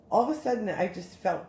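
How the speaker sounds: normal talking voice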